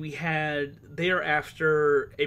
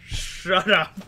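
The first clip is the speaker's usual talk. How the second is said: shout; 5 semitones higher, 11 dB louder, much slower than usual. Real, not acted. Player heckles his woman voice.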